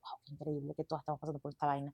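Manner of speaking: very hushed, low private marvelling; inner voice. Retold or imitated. Podcast guest recalling her silent reaction to others' confessions, hushed inner voice. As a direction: think it aloud, barely audible. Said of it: "yo por dentro".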